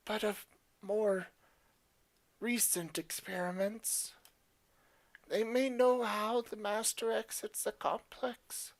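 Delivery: a lightly voiced pleading experiment, half-whispered; weary captive creature. Captive creature begging the player for release, weary and hushed. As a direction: ask the stranger for help, weary and hushed.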